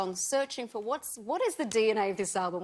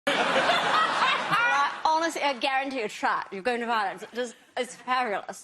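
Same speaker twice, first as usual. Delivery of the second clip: posh British, lips barely touching; posh English accent; 5 semitones higher, 6 dB louder than usual. Shown as a demonstration. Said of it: "You just don't let your lips touch".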